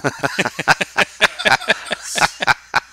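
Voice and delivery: long, hearty burst of laughter; real laugh. Real, not acted. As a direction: laugh heartily at your own joke. Amused by his own slider joke.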